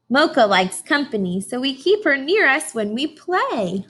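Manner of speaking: slow, bright storybook read-aloud; reading to children. Acted. Teacher reading a picture book to her class, slow storybook voice. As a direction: read each line out slowly for the class.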